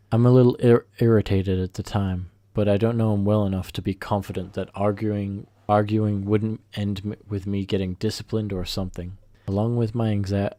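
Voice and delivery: ordinary delivery, nothing marked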